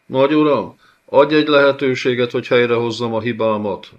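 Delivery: loud, high, fast plea; desperate cry. Acted. Envoy cries out to the lord, begging for another chance. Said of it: "kiáltotta a Szmirné gúr felé".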